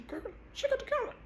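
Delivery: high, fast, hushed alarm; panicked whispered confirmation; frantic hush. Retold or imitated. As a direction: blurt it under your breath. Friend confirms the calendar.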